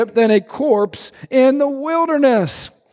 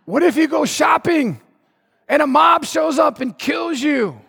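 higher, panicky mock shout; hammed-up panicked friend; 5 semitones higher than usual. Preacher mimicking a panicking friend who imagines disasters. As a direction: play the fearful friend freaking out. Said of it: "they say, Oh, but what if".

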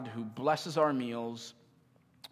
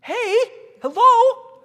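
much higher and louder "Hey, hello"; offended dad shout; 17 dB louder, slower than usual. Retold or imitated. Offended dad calling to his kid who ignored him, shouting high. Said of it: "Cause I'm thinking".

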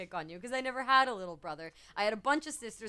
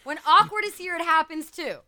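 high, loud protest; 9 semitones higher, 14 dB louder than usual. Real, not acted. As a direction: yelp it out in protest. Protesting loudly.

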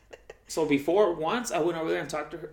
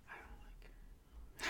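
slow unvoiced whisper; 20 dB quieter, much slower than usual. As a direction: breathe it out slowly like a secret. Confessing quietly.

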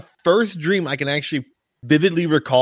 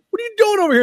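very high-pitched retold question; acted exasperation; much faster than usual. Owner finds the runaway pig, high mock exasperation.

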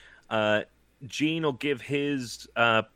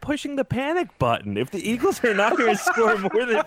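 incredulous; 9 semitones higher than usual. Real, not acted. Incredulous rant at fifty-five.

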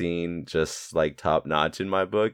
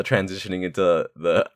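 ordinary talk

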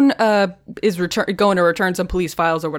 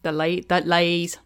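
imitating an Irish accent; 3 semitones lower, 3 dB quieter than usual. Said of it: "he's practicing this accent".

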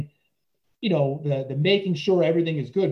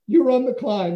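calling out; 9 semitones higher, 5 dB louder than usual. Retold or imitated. Coach hands over control.

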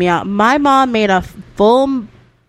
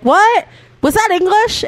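mock disbelief; 12 semitones higher, 4 dB louder than usual. Teasing mom about her English.

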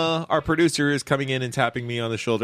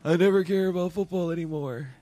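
imitating a miserable fan; teasing, hammed-up misery; mock misery; 4 semitones higher than usual. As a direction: mimic a friend's sulky vow. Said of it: "like, your misery".

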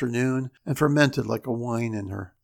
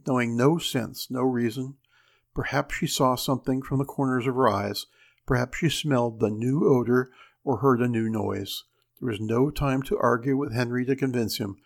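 plain reading voice

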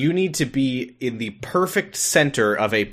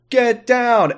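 higher-pitched mock warning call; urgent shouted warning; 11 semitones higher, 4 dB louder than usual. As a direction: call out a quick warning, acted. Acted quote of Graham in danger.

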